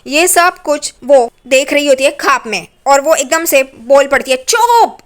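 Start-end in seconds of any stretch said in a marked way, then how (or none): 4.4-5.0 a sharp, high yell of "चुप!"; fed-up mother-in-law shouts at the teasing family; sudden shout. Retold or imitated. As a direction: snap everyone silent with one loud word. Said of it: "तू क्यों चिल्ला रही है?".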